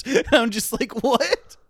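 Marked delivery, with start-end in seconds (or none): 1.0-1.6 high, stunned disbelief; a confused squeak of surprise; baffled what. Retold or imitated. Retold reaction to strange Pokemon fact.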